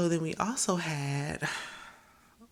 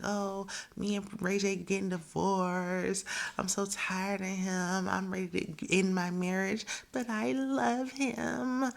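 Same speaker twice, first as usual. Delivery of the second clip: drawn-out mock lament, climbing high at the end; lovelorn complaint; 4 semitones higher, slower than usual. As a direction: drag out the words like a hopelessly attached wife. Acting out friend's complaint about marriage.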